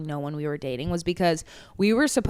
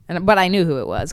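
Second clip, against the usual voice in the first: ordinary talk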